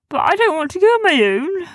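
high, loud whining creature voice; high-pitched childlike protest; reluctant Sniz. Acted. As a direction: plead not to be sent alone. Sniz afraid to climb alone.

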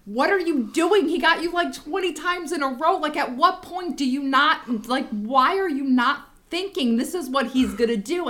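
high, exasperated mock scolding; retold yelling. Mom to Vin, fooled twenty times, exasperated scolding. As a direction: berate him in disbelief, pitched well up. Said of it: "yelling at Vin for being so gullible".

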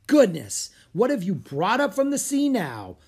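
loud, high-pitched astonished professor; exclaiming. Acted. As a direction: burst out in exasperated surprise. Portly professor exclaims at new arrivals.

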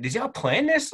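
high, disbelieving question; can't believe what you're hearing; shocked husband, voice jumps up; incredulous. Retold or imitated. Husband learns wives planned pregnancies.

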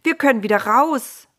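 high, loud, gleeful cry; jubilant. Acted. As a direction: cheer it like you've just been freed. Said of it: "frohlockten Noahs Söhne".

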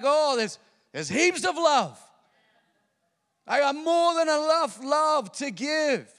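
loud, slow, beaming exclamation; a happy parent marvelling aloud; joyful realization. Retold or imitated. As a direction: announce the overflow of love with delight.

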